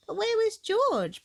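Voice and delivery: put-on little girl wondering; high Peppa voice. A narrator's reading. Peppa asks Mummy where George was.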